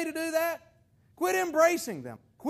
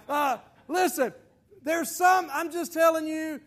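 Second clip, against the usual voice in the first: ordinary talk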